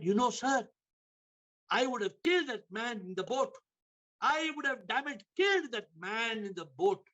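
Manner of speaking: high-pitched, acted indignation; retold outrage. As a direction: play a fisherman fuming at a careless boatman. Fisherman's fury at boatman, acted.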